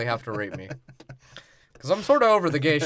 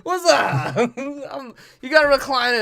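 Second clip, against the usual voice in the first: laughing through the quote; 7 semitones higher, 5 dB louder than usual. Retold or imitated. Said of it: "he's like".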